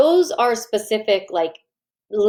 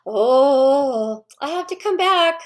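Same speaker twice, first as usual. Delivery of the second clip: mock flustered parent; 4 semitones higher, slower than usual. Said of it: "You're like".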